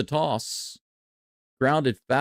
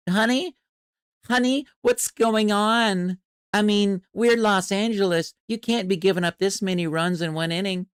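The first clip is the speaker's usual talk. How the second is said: pitcher's wife; 6 semitones higher than usual. Acted. Wife phoning the pitcher about the runs, a hint of a fretful higher voice. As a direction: play the worried wife calling on the phone. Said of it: "His wife just called".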